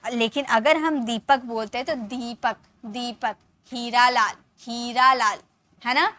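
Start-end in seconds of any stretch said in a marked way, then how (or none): 2.1-3.3 दीपक with the ई held long. Shown as a demonstration. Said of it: "इसे थोड़ा long करके बोला जाता है". 3.7-5.4 हीरा with the ई held long. Shown as a demonstration. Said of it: "इसे थोड़ा long करके बोला जाता है".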